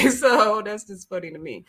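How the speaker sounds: normal talking voice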